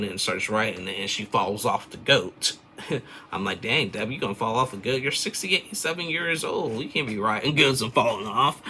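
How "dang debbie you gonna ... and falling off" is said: high-pitched, incredulous teasing; amused mock scolding. Viewer teasing Debbie about falling off the goat, amused mock scolding. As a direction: scold her in rising, laughing disbelief.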